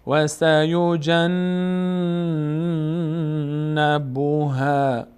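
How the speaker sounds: "سیجنبها" chanted with a very long hummed N; held nasal noon. Shown as a demonstration. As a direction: hold the N of "سیجنبها" in the nose.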